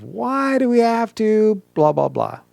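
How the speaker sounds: high, slow whiny protest; drawn-out, high-pitched exasperation; demanding why. Shown as a demonstration. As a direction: stretch it out like a complaint. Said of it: "I want you to actually say".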